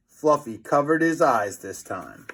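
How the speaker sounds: plain reading voice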